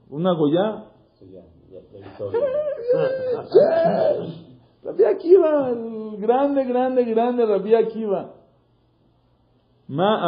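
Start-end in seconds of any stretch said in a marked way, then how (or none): 2.2-4.3 a burst of high laughter, half a cry; high, squealing laugh. Real, not acted. Teacher delighted by the story's happy ending, a high burst of laughter. 4.8-8.3 high, slow, fervent praise of the great rabbi; admiring praise. Real, not acted. Teacher exalting Rabbi Akiva to the class, high and admiring.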